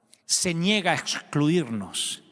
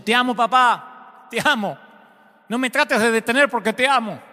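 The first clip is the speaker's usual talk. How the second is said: insistent declaration; 7 semitones higher, 4 dB louder than usual. Retold or imitated. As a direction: keep insisting, raising your voice. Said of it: "continúa diciendo".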